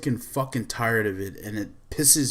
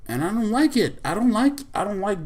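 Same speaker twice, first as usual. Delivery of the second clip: exasperated; 8 semitones higher, faster than usual. Real, not acted. Venting frustration.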